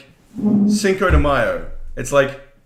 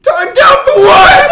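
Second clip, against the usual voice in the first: shouting; 14 dB louder, slower than usual. Acted. Meme shout again.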